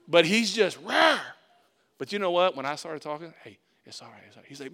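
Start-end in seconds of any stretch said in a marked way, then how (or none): 0.8-1.2 loud, high imitation roar; mock yelling roar. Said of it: "He's yelling". 3.4-4.5 hushed soothing voice; soft. Retold or imitated. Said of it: "I stayed real soft".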